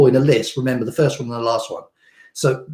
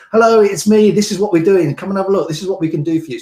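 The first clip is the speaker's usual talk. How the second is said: higher, louder upbeat pitch; energetic hello, punched up and loud; jumped up; 6 semitones higher, 4 dB louder than usual. Shown as a demonstration. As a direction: burst in brightly like an ad opener. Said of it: "you sort of jump it up and say".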